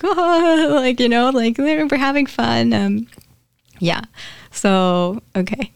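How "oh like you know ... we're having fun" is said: lightly voiced, higher, amused Jesus; a hint of a giggly, relaxed Jesus; laughing stoned Jesus. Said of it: "He keeps laughing and he's like".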